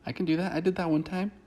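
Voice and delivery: high-pitched, hammed-up insistence; mock eager assurance. He insists to her he can respect that, mock eager and high. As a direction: imitate him overeagerly promising, voice pitched right up.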